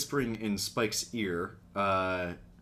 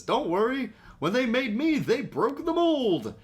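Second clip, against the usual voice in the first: much higher, louder cocky boast; Sunstreaker quote; 14 semitones higher, 4 dB louder than usual. Retold or imitated. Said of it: "where he says".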